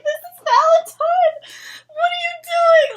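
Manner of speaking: loud, high-pitched disbelieving outcry; exasperated shout. Retold or imitated. Viewer warns Alec it's Valentine, exasperated shout. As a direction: yell at the screen in alarm. Reliving her alarm at the screen.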